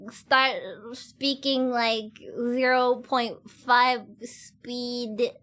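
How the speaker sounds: slowed, drawn-out talking; slow speech. Shown as a demonstration. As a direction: stretch every word out slowly. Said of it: "I'm gonna talk like this".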